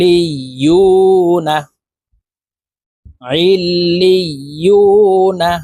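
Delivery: very slow ইলিয়ুনা, long u before a short na; stretched vowel. Shown as a demonstration. Teacher reciting the whole word, stretched vowel. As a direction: chant it, pulling out the ইয়ু of ইলিয়ুনা. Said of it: "এক আলিফ টেনে পড়বো".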